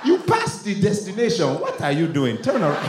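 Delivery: put-on scolding Nigerian accent; Nigerian GPS. Nigerian GPS scolding driver, exasperated Nigerian accent. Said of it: "couldn't have a Nigerian on a G P S".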